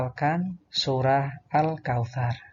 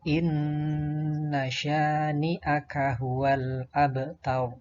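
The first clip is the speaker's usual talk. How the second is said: slow, sustained recitation with long held vowels; Qur'an recitation; much slower than usual. Shown as a demonstration. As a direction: recite it slowly as a model for pupils. Contoh bacaan ayat. (tilawah pelan dengan bacaan panjang)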